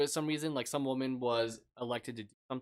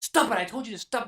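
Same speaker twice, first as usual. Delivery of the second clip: mock parent yell; 9 semitones higher, 6 dB louder than usual. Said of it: "parent go like".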